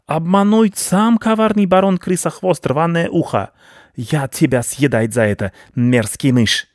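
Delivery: wounded rodent pride in broken speech; outraged rat baron. Acted. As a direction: rage pompously as a tricked rat.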